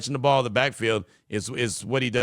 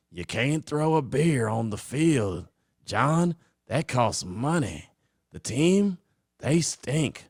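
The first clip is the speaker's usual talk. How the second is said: Jerry Jones impression; much slower than usual. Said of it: "But Jerry Jones, I got that".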